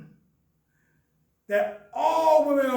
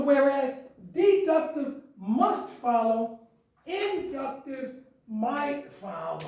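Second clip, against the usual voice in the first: slow, stressing deductive and inductive; teacher stressing must versus might; slow emphatic contrast; slower than usual. Shown as a demonstration. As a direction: spell out the contrast slowly. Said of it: "lecture contrast".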